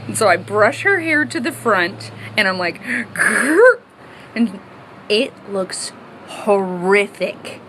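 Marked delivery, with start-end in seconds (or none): 3.1-3.8 high, loud playful growl; mock growl. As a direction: growl with effort as you cut. Brushing hair forward for bangs.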